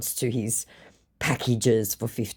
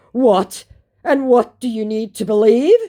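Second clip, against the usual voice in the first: loud, high mock disbelief; mock incredulous; 8 semitones higher, 7 dB louder than usual. Scammer can't believe he is doubted, mock incredulous. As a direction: act affronted at being doubted. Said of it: "Absolutely incredulous that I didn't believe it".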